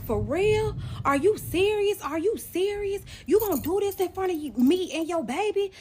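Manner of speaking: high, loud, indignant outburst; retold screaming. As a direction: fuss at them in high-pitched disbelief. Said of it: "I was screaming".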